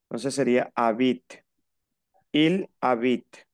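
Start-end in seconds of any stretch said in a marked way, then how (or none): 0.8-1.2 "habit" ending on T, no E; final T sounded. Shown as a demonstration. Teacher sums up the rule, "habit" stopping on T. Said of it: "te queda la T". 2.3-3.5 slow "Il habite", ending on a sounded T; T pronounced. Shown as a demonstration. Teacher models for student, slow "habite" ending on T. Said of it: "Pronunció la T".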